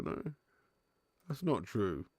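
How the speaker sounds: usual speaking voice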